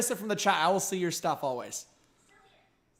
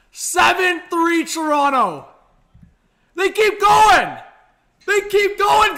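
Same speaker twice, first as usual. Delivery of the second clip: high, loud, slow shouting; goal celebration; 10 semitones higher, 11 dB louder, slower than usual. Real, not acted. Streamer celebrating a Nylander goal live, loud high shouting. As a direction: roar for the goal.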